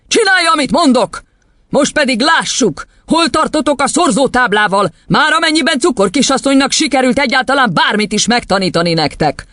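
high, forceful ordering; domineering headmistress. Acted. Headmistress moving on to times tables, domineering. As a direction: command and taunt up high.

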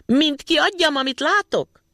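high, loud deliberate yell; shouting at top of lungs. A narrator's reading. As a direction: bellow it to wake the house. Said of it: "torka szakadtából kiabálni".

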